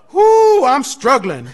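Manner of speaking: preacher mocking overexcited testifiers; mock excited. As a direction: ham up the dramatic struggle. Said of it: "they get excited about it".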